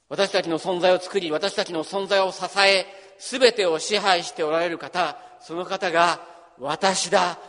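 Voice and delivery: rising proclamation about the Creator; full-force preaching. Acted. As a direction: build it up and proclaim it. Preaching builds to climax.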